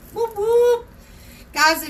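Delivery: high, loud playful whoop whoop; a quick high cheer of welcome; excited whoop. Real, not acted. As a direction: cheer the viewers in. Cheering for viewers joining.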